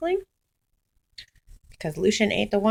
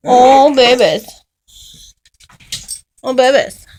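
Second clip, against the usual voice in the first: loud cooing; 3 semitones higher, 13 dB louder than usual. Real, not acted. Cooing loudly at the dogs.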